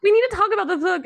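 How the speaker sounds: high, loud, fast urgent plea; eager demand. Retold or imitated. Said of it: "so many people have been like".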